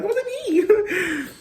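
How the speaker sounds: It wasn't me, stretched out very high and slow; hammed-up fake innocence, very high and slow; mock innocent denial. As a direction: draw out the lie in a high, slow, innocent whine. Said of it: "still had the audacity to lie".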